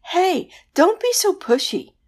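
high, loud complaining wail; high, whiny banshee complaint. A narrator's reading. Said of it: "the banshee wailed".

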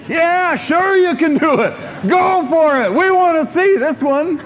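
high, loud eager cheering; crowd cheering. Retold or imitated. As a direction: egg him on like a crowd. Crowd shouting encouragement.

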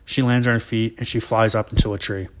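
usual speaking voice

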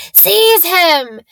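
loud, high command; a furious shriek at his servant; screamed. Acted. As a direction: bark the order with fury. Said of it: "Voldemort screamed".

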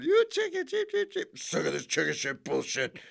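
high squeaky taunting voice; mocking Tommy Wiseau. Quoting The Room chicken taunt, squeaky high. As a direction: taunt like a silly schoolyard bully. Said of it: "Just for that line alone".

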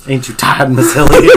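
joking southern twang. Acted. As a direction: tease in a put-on southern twang. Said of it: "little southern twang".

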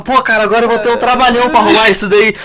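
mock exasperation; annoyed retort. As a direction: grumble at the friend's mistake. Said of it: "Eu falava".

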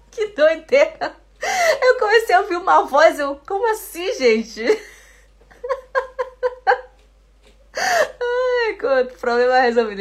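loud, high, helpless laughter while talking; real laughing fit. Real, not acted. Teacher recounts hearing a stray voice in her headphones, laughing hard. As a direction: crack up and squeal through the words. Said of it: "Tô rindo aqui".

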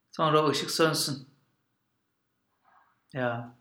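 ordinary delivery, nothing marked

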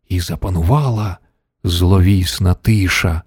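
usual speaking voice

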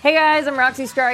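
ordinary talk, nothing marked

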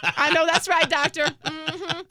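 gleefully rat out mom to the doctor; sassy tattling. Retold or imitated. Sassy agreeing about tattling mom.

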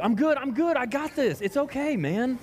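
high, breezy imitation of a friend; a hint of a carefree buddy saying all is fine; mock reassuring friend. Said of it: "lean on someone else".

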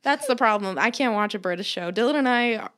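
ordinary talk, nothing marked

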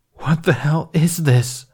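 slow, high anguished plea; despairing. A narrator's reading. Despairing man to an unseen party, slow anguish. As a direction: plead with the universe in despair. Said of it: "despaired to some unseen third party".

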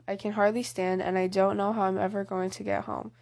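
ordinary delivery, nothing marked

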